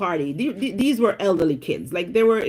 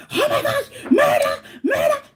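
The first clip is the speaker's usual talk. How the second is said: mock scream. Screaming at slug on hill.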